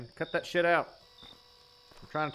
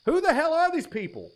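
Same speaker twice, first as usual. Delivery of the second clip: higher, louder fed-up cry; exasperated outburst; 11 semitones higher, 5 dB louder than usual. Real, not acted. Ex-detective ranting at the case file, exasperated. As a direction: blow up at the report.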